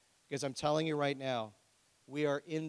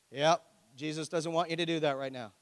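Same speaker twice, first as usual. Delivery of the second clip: ordinary delivery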